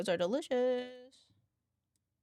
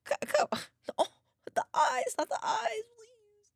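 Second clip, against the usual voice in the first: high, pleading not the eyes; playful high whine; mock pleading; 14 semitones higher than usual. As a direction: beg the cat not to judge you. Begging cat not to stare.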